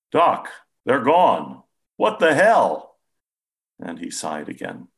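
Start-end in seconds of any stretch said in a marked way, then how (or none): 0.1-3.0 slow, much higher and louder disbelief; stunned amazement; astonished. A narrator's reading. Patient to his doctor as the currents vanish, astonished.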